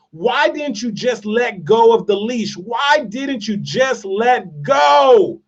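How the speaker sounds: emphatic retold plea, word by word on "let go"; exasperated questioning. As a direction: stretch out "why didn't you just let go", hitting GO hard. Retold pointed question, repeated and building.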